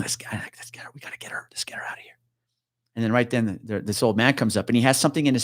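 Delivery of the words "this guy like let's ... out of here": hushed aside to a partner; barely audible, conspiratorial whisper; urgent whisper. Retold or imitated. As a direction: whisper it secretly, wanting out. Urgent aside to fellow officer, retold.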